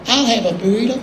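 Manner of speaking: lightly voiced country singer echoing an order; a hint of an impersonated country friend. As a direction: copycat order in another man's voice. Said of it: "and [name] goes".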